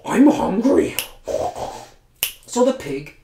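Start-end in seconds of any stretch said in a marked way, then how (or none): 0.0-1.0 much higher and louder I'm hungry, whiny; loud hungry pig. Acted. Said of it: "and said".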